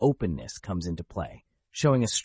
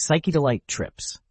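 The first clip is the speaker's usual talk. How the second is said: booming announcer; 5 semitones higher than usual. Acted. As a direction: boom it out like a showman. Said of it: "In a theatrical, booming voice".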